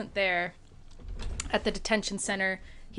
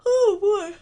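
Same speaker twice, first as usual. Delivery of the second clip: yawning; 12 semitones higher, 8 dB louder than usual. Real, not acted. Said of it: "why I'm yawning so much".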